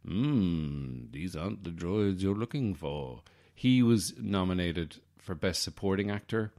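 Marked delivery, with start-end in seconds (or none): 0.1-3.2 mock old Jedi master impression; hammed-up Obi-Wan impression; Alec Guinness. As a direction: put on an English actor's measured voice. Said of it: "And Alec Guinness".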